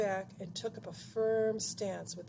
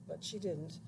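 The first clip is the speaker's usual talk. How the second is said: ordinary delivery, nothing marked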